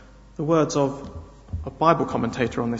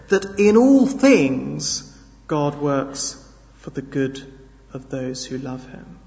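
normal talking voice

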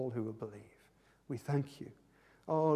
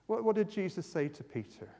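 ordinary talk

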